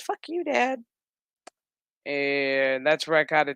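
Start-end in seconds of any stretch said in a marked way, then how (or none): 0.0-0.8 bratty daughter. Retold or imitated. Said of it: "And she said".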